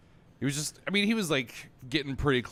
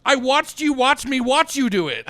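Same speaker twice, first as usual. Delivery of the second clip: higher, louder, slow emphatic callout; exasperated callout; 11 semitones higher, 7 dB louder than usual. Retold or imitated. Bartender confronting a spitting customer, loud and slow. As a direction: spell it out to him, loud and deliberate. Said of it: "I'm like".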